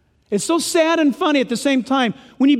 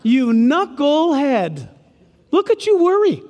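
mock-scolding God teasing a worrier; much slower than usual. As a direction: rub it in playfully. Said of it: "God's rubbing it in".

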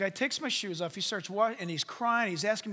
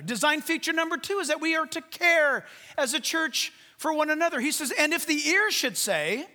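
louder, higher pulpit voice; fervent preaching; 5 semitones higher, 6 dB louder than usual. Acted. Pastor urging his congregation, fervent preaching. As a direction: drive the point home to the church.

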